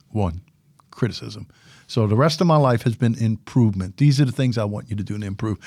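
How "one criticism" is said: low and soft, almost to himself; dropped, quiet word. Shown as a demonstration. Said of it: "you know what word I hear?".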